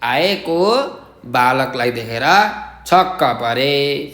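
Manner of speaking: slow, even dictation pace, each word set apart. Shown as a demonstration. Teacher dictating to pupils, slow and even. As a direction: read it out slowly so pupils can write it down. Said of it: "ध्यान दिएर सुन्नुहोस् अनि लेख्नुहोस्।".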